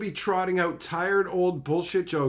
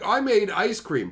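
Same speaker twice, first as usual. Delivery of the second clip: mock proud claim; 6 semitones higher, 4 dB louder than usual. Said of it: "It's like".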